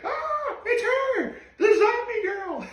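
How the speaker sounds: squealed way up high, the last words dragged out slow; excited Summers. Retold or imitated. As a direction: shriek it like a gleeful creep spotting his prey. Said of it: "Summers appears right in front of Brook and is like".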